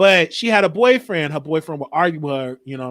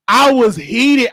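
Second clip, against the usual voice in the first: ordinary talk, nothing marked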